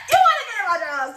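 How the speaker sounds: high, laughing tease; laughing repeat. Real, not acted. Teller repeats the joke to herself, laughing and high. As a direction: tease through laughter, pitch jumping up.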